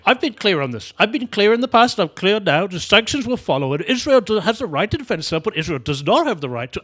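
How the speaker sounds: hammed-up robotic spin-doctor voice; robotic politician. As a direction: parrot the party line like a robot. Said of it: "that kind of robotic repetition".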